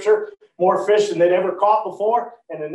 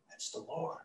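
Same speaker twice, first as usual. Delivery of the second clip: hushed whisper of awe; whispered recognition; 20 dB quieter than usual. Acted. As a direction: breathe it out in wonder. John recognises Jesus on shore.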